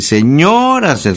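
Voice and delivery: señoras slow, with a high stretched O; exaggerated stress on O. Shown as a demonstration. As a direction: lean hard on the o of señoras. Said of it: "con acento en la O de señoras". (señoras, con la o alargada y aguda)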